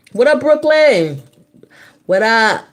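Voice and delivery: loud, high shout-out; hype greeting. Real, not acted. Streamer shouting hello to Brooklyn viewers. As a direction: call out to the crowd with energy.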